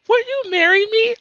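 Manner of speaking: high mock proposal voice. Mocking the gamer's jet ski proposal, high and hammed-up. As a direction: squeak it out like a silly proposal. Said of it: "It's like".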